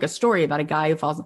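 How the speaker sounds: ordinary talk, nothing marked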